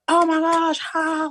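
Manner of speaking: very high crowd cheer at normal volume; high-pitched, held-back scream; mock screaming. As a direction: imitate fans squealing and cheering. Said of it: "like, screaming, cheering".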